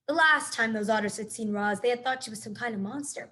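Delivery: usual speaking voice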